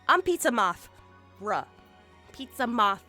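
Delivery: ordinary delivery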